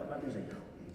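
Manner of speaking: normal talking voice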